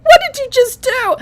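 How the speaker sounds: put-on high, squeaky baby demanding an answer; outraged newborn. Newborn confronting the doctor who slapped her, high and shocked. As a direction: shriek your outrage as a tiny baby. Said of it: "I was like".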